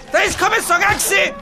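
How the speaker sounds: shouted taunt. Acted. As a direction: yell the taunt back at them. Repeats the taunt louder. (φωνάζει κοροϊδευτικά)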